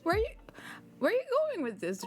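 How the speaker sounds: ordinary delivery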